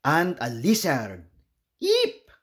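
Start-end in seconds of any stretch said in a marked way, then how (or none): 1.8-2.3 startled squeak. Acted. Startled by a lizard.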